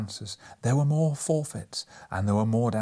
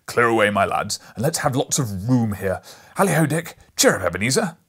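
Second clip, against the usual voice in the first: jolly Fezziwig shout; 9 dB louder than usual. A narrator's reading. Fezziwig calls his boys to stop work, loud and hearty. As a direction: boom it like a merry old master.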